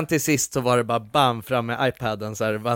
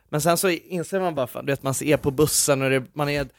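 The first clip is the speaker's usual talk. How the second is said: ordinary delivery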